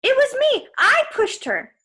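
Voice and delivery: high, loud, pleading confession; desperate Grover. A narrator's reading. Grover covering for Percy, high desperate voice. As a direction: throw yourself in front of the blame.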